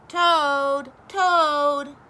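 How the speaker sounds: loud high call through the door; shouting. A narrator's reading. Frog shouting at Toad's front door. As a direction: shout his name at the closed door. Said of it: "shouted Frog".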